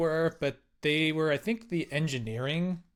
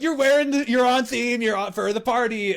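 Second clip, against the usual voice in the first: high, louder cheerful news; excited bouncers; 8 semitones higher, 5 dB louder than usual. Retold or imitated. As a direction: tell him he's on theme, thrilled. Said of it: "they're like".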